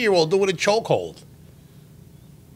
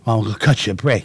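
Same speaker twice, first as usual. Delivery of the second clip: low, quick imitation of the cop; mock state trooper; 8 semitones lower, faster than usual. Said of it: "he goes".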